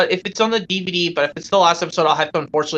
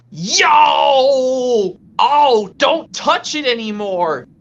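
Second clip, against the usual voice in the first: gasp, then loud high cry of pain; hurt cartoon character; 6 semitones higher, 4 dB louder, much slower than usual. Hurt character warning off friends, pained acted wail. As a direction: yelp and beg them to stop. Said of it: "why don't we do a quick impression".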